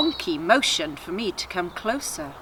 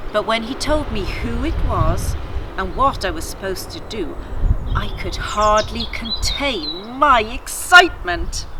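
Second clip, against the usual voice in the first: put-on steady storytelling read to children; story narration; 4 dB louder than usual. A narrator's reading. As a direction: tell it like a bedtime tale. Reads story narration aloud.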